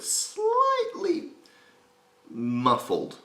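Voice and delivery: very high, drawn-out squeak; SpongeBob. Shown as a demonstration. Coach describing SpongeBob's grain, high cartoon squeal. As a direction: stretch it out in a squeaky cartoon pitch.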